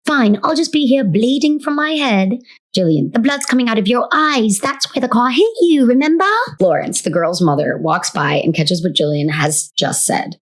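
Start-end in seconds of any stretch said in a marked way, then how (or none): 0.0-2.4 put-on high-pitched little British girl; huffy child with a high British accent; Jennifer. Jennifer answering her sister in play, high British voice. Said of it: "They're sounding like little Hermione's". 3.1-6.3 put-on high-pitched British little girl; insistent child, high British accent; Jillian. Jillian reminding her sister in play, high British voice. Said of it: "They're sounding like little Hermione's".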